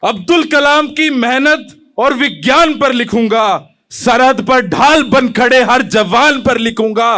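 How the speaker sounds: measured, declaimed patriotic verse; poem recitation. Acted. Young poet opens his poem to the audience, declaimed. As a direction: recite it to a hall, line by line.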